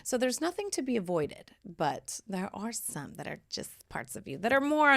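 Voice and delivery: usual speaking voice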